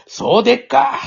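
high-pitched, put-on Kansai reply; exaggerated Kansai dialect. Advising a colleague to lean into it, hammed-up Kansai dialect. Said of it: "もう関西弁が".

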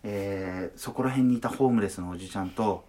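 low, hushed, grave documentary narration; documentary narrator voice. Retold or imitated. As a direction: read it like a dark TV documentary. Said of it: "ナレーションの真似をします。".

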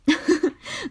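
light real giggle; bright laugh. Real, not acted. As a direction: laugh at her own question. Laughs at her own joke.

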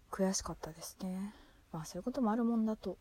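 breathy whisper of regret. Real, not acted. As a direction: whisper it, frustrated. Regretting lost retirement match.

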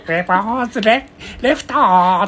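put-on high, thin old boss voice. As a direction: call it high and reedy. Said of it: "高くて細くないと".

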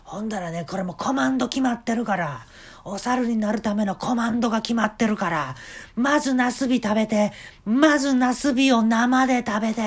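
high, loud eager boss voice; a showman giving orders; eccentric shop owner. Retold or imitated. Owner voiced in a retold story. (店の大将が客に猿になる手順を指示する、高く大きな声)

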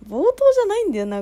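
self-correction. Real, not acted. Corrects herself mid-sentence.

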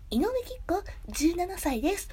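high, cute voice-actress introduction; Inoue. Acted. Streamer posing as a seventeen-year-old actress, high cute imitation. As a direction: lift it into a cute mock voice. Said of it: "可愛い声とかさ".

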